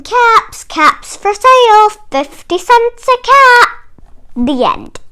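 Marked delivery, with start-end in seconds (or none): 0.0-3.7 high, loud street call. Acted. Peddler calling out to buyers, high and loud. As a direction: cry your wares down the road.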